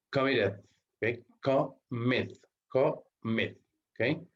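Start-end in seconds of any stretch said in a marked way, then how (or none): 1.4-3.6 "Commit, commit" each broken into co... MIT; syllables split. Shown as a demonstration. Teacher counting syllables for students, "Commit" split as co... MIT. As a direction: pause inside each "commit" to show two beats. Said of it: "Tiene dos sílabas".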